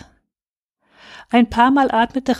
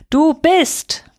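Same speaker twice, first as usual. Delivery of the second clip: higher, louder cry into the storm; called out; 7 semitones higher, 4 dB louder than usual. A narrator's reading. Boy lifting his blanket, calling into the storm. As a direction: call it out over the wind. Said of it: "rief nun in den Sturm hinaus".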